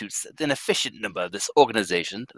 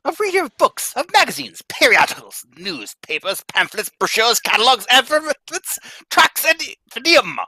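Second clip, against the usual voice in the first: indignant boss; 8 semitones higher than usual. Acted. President berating the teller for reading, building outrage. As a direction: list every offense with mounting outrage.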